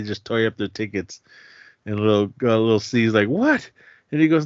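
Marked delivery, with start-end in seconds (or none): 3.3-3.7 surprised what. Retold or imitated. Little C confused by torn tickets.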